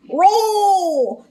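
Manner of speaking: dog grunting pull; Scooby voice. Acted. Scooby pulls stuck door.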